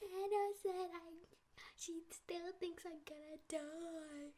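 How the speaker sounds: high, loud, slow whining tattle; tattling sister. Retold or imitated. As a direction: whine to Dad like a hurt little sister.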